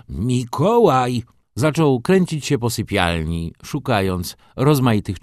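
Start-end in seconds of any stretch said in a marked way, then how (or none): 0.1-1.2 slow, louder call to the servant. A narrator's reading. Father calls his servant Mikołaj, loud and drawn out. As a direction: summon the servant from the next room.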